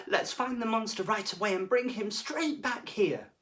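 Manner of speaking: rally the school to find him; deputy head urging everyone, high and eager. Acted. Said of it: "said Mister Jed".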